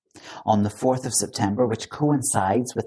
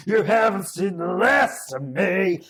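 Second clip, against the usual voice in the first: higher, slow villain snarl; hammed-up sputtering foiled villain; Orloff doing [name]; 8 semitones higher, slower than usual. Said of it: "that was my impression of Orloff doing [name]".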